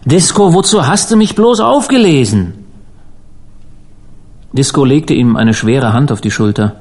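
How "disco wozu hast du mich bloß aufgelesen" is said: high, wailing reproach; anguished moan. A narrator's reading. Rescued stranger pleads with Disco, anguished high wail. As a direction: wail it, climbing high on "bloß" and falling away. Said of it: "stöhnte der Fremde".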